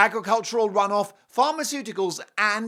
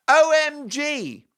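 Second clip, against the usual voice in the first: excited; 10 semitones higher, 6 dB louder, much slower than usual. Acted. Gushing over sponsor.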